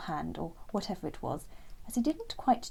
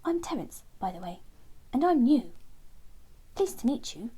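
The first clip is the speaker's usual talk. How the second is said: a bit higher, polite; polite little bird; 4 semitones higher, 3 dB louder than usual. Acted. Terence greeting Lenny, lightly voiced polite bird. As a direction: introduce yourself shyly.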